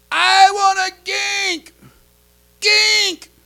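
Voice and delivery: put-on high, loud, slow child's demand; toddler yelling. Toddler shouting from bed at his mother for water. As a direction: wail it from the bedroom.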